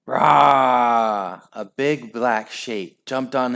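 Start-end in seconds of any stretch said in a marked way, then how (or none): 0.1-1.4 dog roar. Acted. Dog leaps on Henry.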